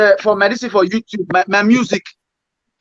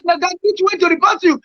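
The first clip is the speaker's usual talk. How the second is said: ordinary delivery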